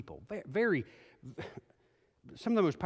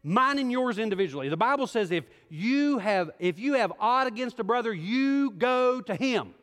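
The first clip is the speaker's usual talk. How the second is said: high, loud preacher's build, driving hard; rising sermon fervor, loud and high; full-force preaching; 6 semitones higher, 6 dB louder than usual. Acted. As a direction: preach it to the whole room, pressing.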